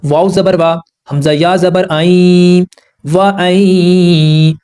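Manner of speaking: slow, drawn-out tajweed spelling of वा आई; spelling out letters. Shown as a demonstration. Teacher spelling out letters slowly for students. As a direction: spell each letter, then stretch वा आई. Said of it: "अब यहाँ पर क्या rule है?".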